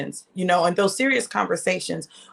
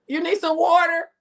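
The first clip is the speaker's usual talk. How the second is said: calling out; 10 semitones higher, 3 dB louder than usual. Real, not acted. Calling back to daddy.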